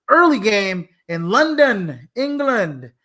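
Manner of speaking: a self-conscious attempt at a British accent on the London game. Host announcing the London game in a mock British accent. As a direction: slip into a put-on British accent, slowed down. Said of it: "that's my best accent".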